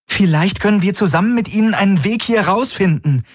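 hoch, FÜNF schrill betont; bestimmt, mit verdeckter Furcht; bestimmt, selbstsicher. A narrator's reading. Kirai bietet an. (Kirai, hoch und bestimmt, Angst überspielend)

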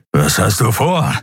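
tense growl; growled question. A narrator's reading. Keru asks the pilot about her plan, growling. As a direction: growl it suspiciously. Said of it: "knurrte Keru".

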